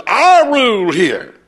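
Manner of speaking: mock bossy woman, high and drawn out. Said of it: "she says".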